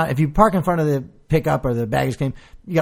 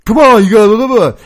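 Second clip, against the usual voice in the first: mock cop; 8 semitones higher, 10 dB louder, faster than usual. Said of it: "he says something more like".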